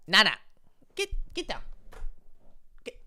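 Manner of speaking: high sharp get down to the cat; scolding the cat. Real, not acted. Streamer shooing his cat off the desk, high and sharp.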